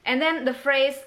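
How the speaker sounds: ordinary talk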